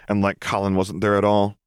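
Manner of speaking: ordinary delivery, nothing marked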